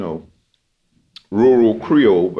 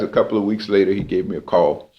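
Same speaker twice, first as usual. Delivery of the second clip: normal talking voice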